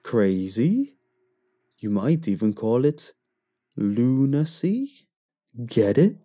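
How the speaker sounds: joking moon. A narrator's reading. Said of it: "the moon laughing".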